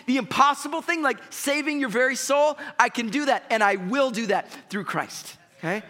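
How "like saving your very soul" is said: ordinary talk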